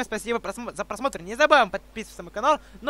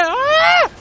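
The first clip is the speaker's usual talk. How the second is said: startled scream; 8 dB louder than usual. Real, not acted. Shout at glitch.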